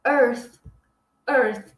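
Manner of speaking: Earth with the tongue between the teeth and a small puff of air; careful Earth demo; English Earth. Shown as a demonstration. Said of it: "é meio que esse er".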